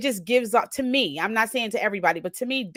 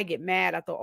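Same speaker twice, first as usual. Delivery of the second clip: ordinary talk, nothing marked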